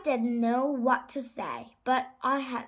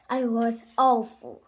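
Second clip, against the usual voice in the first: plain reading voice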